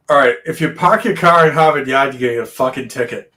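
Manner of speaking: thick New England accent; natural Boston accent. Shown as a demonstration. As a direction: drop the r's like a Boston native. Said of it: "this is how I actually talk".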